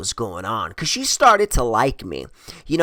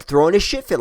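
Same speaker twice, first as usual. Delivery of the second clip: ordinary talk, nothing marked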